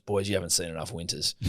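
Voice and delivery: low, quiet deadpan warning; matter-of-fact. Retold or imitated. Uber driver warning the boys, matter-of-fact. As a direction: say it softly, as a plain fact. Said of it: "he just said it so matter of fact".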